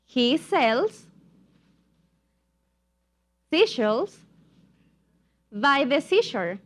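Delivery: short chunks with long pauses; repeat-after-me modelling. Shown as a demonstration. Teacher has students repeat after her, chunk by chunk. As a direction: leave a gap after each chunk. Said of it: "You're going to repeat after me".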